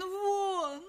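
very high, long wail without volume; hysterical cry. Acted. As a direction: stretch one word into a frantic cry. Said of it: "истошно закричала Мадлен". (истошно, протяжно)

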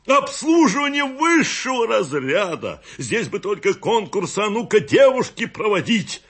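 high, ringing, hammed-up raptures; over-the-top praise, pitched very high; grand proclamation. A narrator's reading. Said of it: "провозгласил пятый".

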